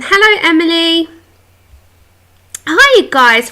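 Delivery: ordinary talk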